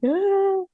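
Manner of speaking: high open sigh of letting go; release sigh. Retold or imitated. Sitting in pigeon pose, sighing as she lets the tears come. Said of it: "and then have a good cry".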